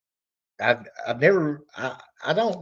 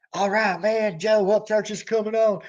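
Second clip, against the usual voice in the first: higher, eager hype to a buddy; excited concert fan; 8 semitones higher than usual. Pretend. Fan nudging [name] as [name] takes the stage, excited and higher. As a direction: tell your friend your favourite just walked on.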